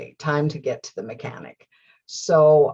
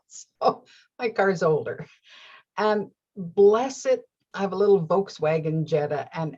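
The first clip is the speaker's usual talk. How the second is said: normal talking voice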